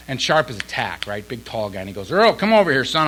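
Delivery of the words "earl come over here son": high, loud imitated summons; raised voice, summoning with authority; calling someone over. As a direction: imitate an old man calling out to a younger one. Old man calling him over, retold.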